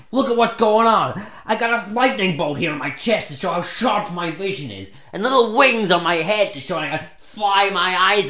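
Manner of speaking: escalating exasperated outburst; angry rant. Acted. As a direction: rant indignantly, losing your temper. Anger rant until therapist intervenes.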